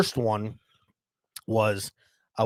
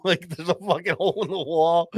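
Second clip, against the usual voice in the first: higher, laugh-shaken words; laughing while talking; 4 semitones higher than usual. Real, not acted. Storyteller amused by the hole he left, laughing through it. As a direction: talk through a real laugh.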